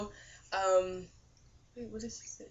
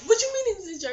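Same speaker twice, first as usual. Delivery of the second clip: shocked question; 12 semitones higher, 6 dB louder, faster than usual. Retold or imitated. Shocked at Jesus in jar.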